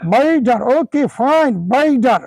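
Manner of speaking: American বেটার, d in place of t; American accent. Shown as a demonstration. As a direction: echo বেটার as American "bai-dar". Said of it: "American accent?". (American ধাঁচে বেটার)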